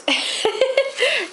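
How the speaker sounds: a high, sheepish giggle at herself; embarrassed laugh. Real, not acted. Harry Potter nerd admits the colors bothered her, sheepish high laugh. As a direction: laugh off your own nerdiness.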